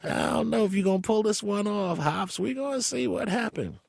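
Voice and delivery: high raspy imitation of a friend. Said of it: "[name] talk with the raspy voice".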